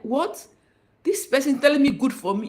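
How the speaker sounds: mock outraged disbelief; indignant what. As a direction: sound stung by the cold reply. Voices shocked disbelief.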